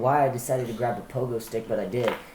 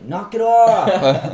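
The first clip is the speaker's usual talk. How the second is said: loud, high, slow bellow; dad yelling; 11 semitones higher, 11 dB louder, much slower than usual. Retold or imitated. Dad shouting from the backyard, slow loud yell. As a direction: boom it across the backyard. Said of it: "My dad from the backyard was like".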